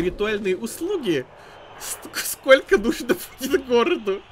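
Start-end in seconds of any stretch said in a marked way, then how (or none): 1.8-4.2 apologizing through nervous laughter; giggling nervously, trying to stop. Real, not acted. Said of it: "Это, это нервный смех".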